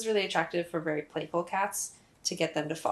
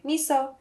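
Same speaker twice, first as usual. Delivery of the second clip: "Miso" called high and louder, rising on the last syllable "so"; rising name call; 7 semitones higher, 5 dB louder than usual. Shown as a demonstration. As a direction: sing out "Miso" with an upward lift at the end. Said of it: "I try to go upwards with my inflection on the last syllable".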